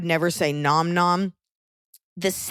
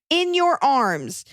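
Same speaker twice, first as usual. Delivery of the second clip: mock cutesy; 8 semitones higher, much slower than usual. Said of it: "in green".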